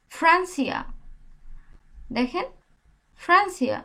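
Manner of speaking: ফ্রান্সিয়া with a ফ sound at the start; Spanish pronunciation. Shown as a demonstration. Teacher demonstrating the F sound in Francia. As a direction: Francia with Bengali ফ. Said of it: "ফ এর মতো উচ্চারণ হয়েছে না?".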